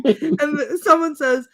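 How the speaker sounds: ordinary delivery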